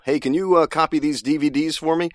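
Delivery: high, breezy everyday request; lightly voiced casual office guy; casual modern voice. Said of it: "they don't mean, for example".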